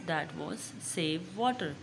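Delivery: ordinary delivery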